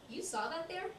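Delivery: high, rising disbelief; astonished question. Acted. Woman answering Bob's praise, high astonished question.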